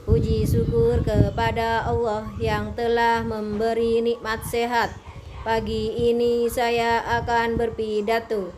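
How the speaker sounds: imitating a primary pupil's reading intonation; schoolchild reading aloud. Shown as a demonstration. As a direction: recite it like a young pupil reading out a speech. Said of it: "Jangan sampai seperti intonasi anak S D".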